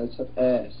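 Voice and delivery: ordinary talk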